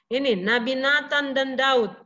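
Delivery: usual speaking voice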